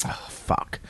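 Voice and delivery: very soft, voiceless groan of dread; dismayed whisper. Retold or imitated. Patrice sees the police roadblock, whispered dismay. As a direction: breathe it out, sunk.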